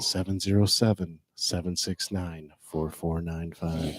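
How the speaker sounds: low, hushed documentary narrator; dramatic label reading. Acted. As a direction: read the label like an epic tale. Reads the bottle label aloud.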